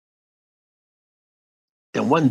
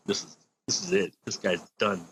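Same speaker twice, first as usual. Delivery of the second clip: quietly resigned; 4 semitones lower, 12 dB quieter than usual. Retold or imitated. Manager writing him off, retold.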